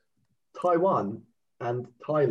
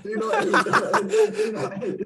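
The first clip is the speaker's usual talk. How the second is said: much higher, slow, laughing; squeaky laughing warning; 4 dB louder, much slower than usual. Acted. Mock warning, rising into laughter.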